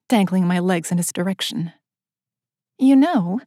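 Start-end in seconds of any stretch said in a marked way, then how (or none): none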